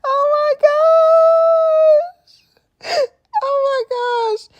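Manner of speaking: high laughing wail; laughing cry. Real, not acted. Host reacting to the interview, high laughing wail. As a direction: crack up in disbelief.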